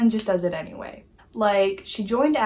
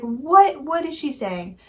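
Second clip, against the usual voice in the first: baffled, with a raised first "What"; higher, puzzled outburst on the first word; retold confusion; 5 semitones higher, 5 dB louder, slower than usual. Vlogger recalls first hearing the character speak, confused. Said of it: "I was so confused".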